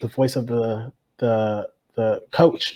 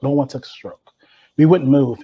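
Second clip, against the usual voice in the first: usual speaking voice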